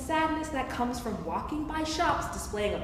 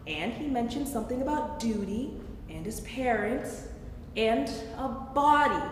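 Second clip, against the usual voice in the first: ordinary talk, nothing marked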